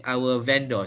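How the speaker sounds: VENDOR said "VEN-dor"; wrong pronunciation. Shown as a demonstration. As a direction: end VENDOR on a full "or" vowel. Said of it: "So it's not vendor".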